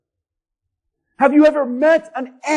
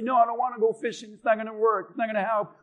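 soft, quick brush-off; dejected refusal; 3 semitones lower, 7 dB quieter, much faster than usual. Acted. Acted grieving dad refusing his children's fishing trip, soft dejection. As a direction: turn them down in a softer, defeated mumble.

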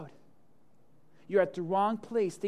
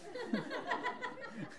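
high squealing laugh; 11 dB quieter than usual. Real, not acted. Laughs at own story.

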